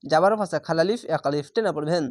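usual speaking voice

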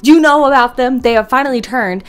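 dramatic news-anchor announcement; newscaster voice. Acted. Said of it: "my newscaster voice".

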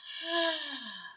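a long, soft exhale to model calm breathing; calming sigh. Shown as a demonstration. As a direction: breathe out slowly so the child can copy. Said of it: "like that".

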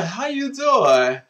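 big showy catchphrase greeting; signature greeting. Acted. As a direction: stretch out "doing" like a trademark hello.

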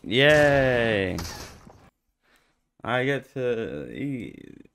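normal talking voice

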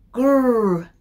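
a loud animal growl; tiger growl. Acted. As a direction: growl like a big cat. Scary noise in the story.

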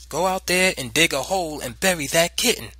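loud, high commanding mother; angry order. A narrator's reading. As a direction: drive the child out with orders. Mother orders child to bury kitten.

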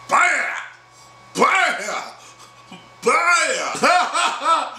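high, punchy "baya" for each blow; mock impact shouts. Storyteller acts out the head hitting curb, high mock shouts. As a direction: shout out the hits one by one. Imitating blows in the retold fight.

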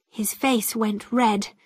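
usual speaking voice

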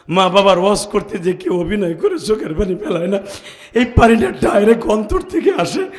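loud preaching building to a high wail; impassioned tearful preaching. Real, not acted. Motherless preacher confessing to the crowd, tearful and impassioned.

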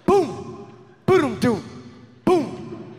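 loud, high thumps from the trunk; imitated banging noise. Comic imitating thumps from his car trunk, loud banging sounds. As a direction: bang it out like something kicking the trunk.

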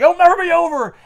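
high, loud, hammed-up defiance; dramatic cry. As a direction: cry it out like a melodrama villain.

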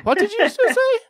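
very high, loud squeak of disbelief; shocked disbelief. Retold or imitated. Dad shocked by his daughter's British word, high incredulous squeak. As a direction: yelp it like a stunned dad. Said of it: "I was like".

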